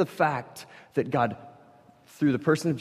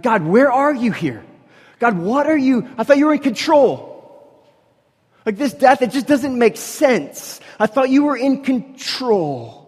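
loud, high, pleading outcry; desperate high-pitched plea to an absent God; anguished cry to God; 12 semitones higher, 8 dB louder than usual. Retold or imitated. Acting a doubter crying to God.

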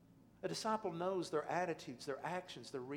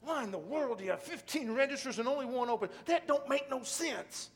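loud, high exasperated outburst; a frustrated customer fed up with the single open register; getting mad; 11 semitones higher, 10 dB louder than usual. Retold or imitated. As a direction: vent at the store out loud. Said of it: "I started getting mad".

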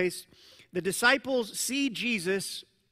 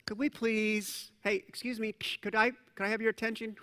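mock polite request; 4 dB quieter than usual. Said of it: "That's not the way this worked".

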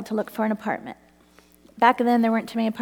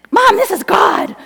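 much higher and louder; excited declaration; 10 semitones higher, 9 dB louder than usual. Retold or imitated. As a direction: announce it with awe. Declaring to mom in the car.

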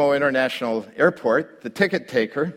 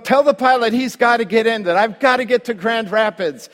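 higher, louder, pressing and anxious; urgent plea; 10 semitones higher, 5 dB louder than usual. Retold or imitated. Pastor urging the controller, raised and anxious. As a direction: push him to get you there.

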